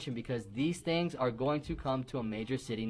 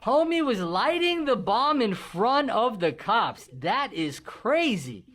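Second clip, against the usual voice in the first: shocked exclamation; 6 semitones higher, 6 dB louder, much slower than usual. Real, not acted. Reacting to bomb video.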